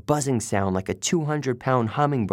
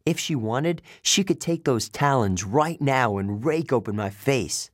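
usual speaking voice

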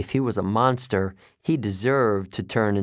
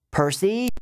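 high-pitched, urgent call; yelled; 10 semitones higher than usual. A narrator's reading. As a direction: call the name out sharply, pitched way up. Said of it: "Annabeth yelled".

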